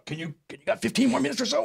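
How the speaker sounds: high-pitched, hesitant plea; imitating an anxious request; imitated club guy. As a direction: stammer a favor in a high mock voice. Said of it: "The guy's going".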